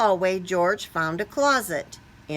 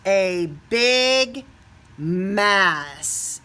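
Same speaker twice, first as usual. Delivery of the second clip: big reveal; 4 dB louder, much slower than usual. A narrator's reading. Reveals the mess.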